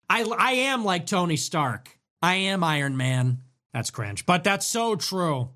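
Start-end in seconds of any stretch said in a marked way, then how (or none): none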